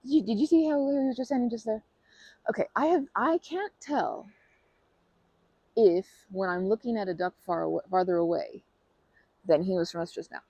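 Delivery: normal talking voice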